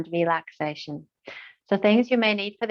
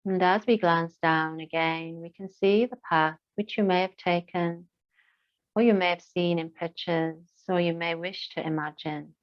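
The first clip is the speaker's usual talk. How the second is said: guided meditation. Acted. Yoga teacher leading listeners through breath and moorland, guided meditation. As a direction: guide them gently into stillness, phrase by unhurried phrase.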